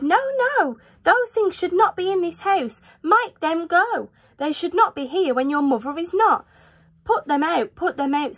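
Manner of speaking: high, anxious fish voice protesting; a worried little fish crying no; fretful fish. Acted. Fish in the pot warning the children, high and anxious.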